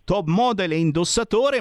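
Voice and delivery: normal talking voice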